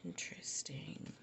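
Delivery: breathy whisper to herself; soft whispered aside. Real, not acted. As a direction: murmur it under your breath. Quiet reaction to drawn card.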